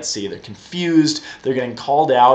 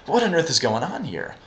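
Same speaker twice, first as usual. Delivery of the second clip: ordinary talk, nothing marked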